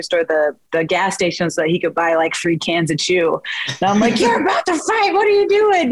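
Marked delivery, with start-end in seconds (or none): 4.2-5.9 exasperated yell. Retold or imitated. To a teammate buying chew before a fight, exasperated shout. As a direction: blurt it out in disbelief, pitched way up.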